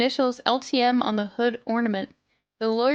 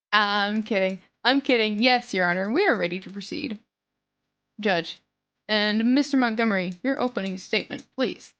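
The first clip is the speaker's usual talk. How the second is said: ordinary delivery, nothing marked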